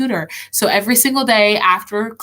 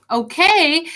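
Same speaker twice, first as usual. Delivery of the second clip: a higher, slightly miffed okay echoing her; mock-hurt repeat of the teacher's word; mildly frustrated okay; 7 semitones higher than usual. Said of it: "that kinda got me a little frustrated".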